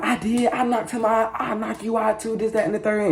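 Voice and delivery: put-on high, cocky kid voice boasting; squeaky mock bravado, playing my younger self; bragging kid. Thirteen-year-old boasting to the neighbor mom, high and cocky. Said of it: "So I'm like".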